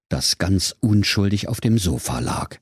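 ordinary delivery

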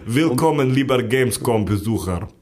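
slow, bad Russian dubbing accent. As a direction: dub it like a cheap Russian voice actor. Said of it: "Synchronsprecher mit russischem Akzent".

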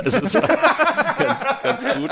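high giggly laugh through words. Real, not acted. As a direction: laugh while confessing it. Admits being glad of delay.

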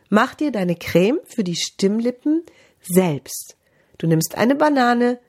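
ordinary talk, nothing marked

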